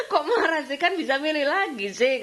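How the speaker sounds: high, laughing mock disbelief; teasing laugh. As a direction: laugh through the tease. Teasing about husband's anger. (menggoda sambil tertawa, nada tinggi)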